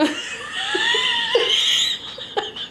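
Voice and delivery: squealing high laugh; helpless shrieking laugh; high laughter fit. Real, not acted. Cracking up.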